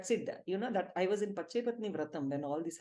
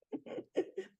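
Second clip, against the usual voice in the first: soft breathy laugh; 11 semitones higher, 7 dB quieter than usual. Real, not acted. Laughs at own story.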